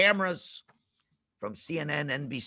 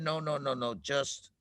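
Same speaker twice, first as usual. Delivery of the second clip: soft, confident; 6 semitones lower, 7 dB quieter, slower than usual. Acted. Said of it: "by Moshe speaking softly and confidently".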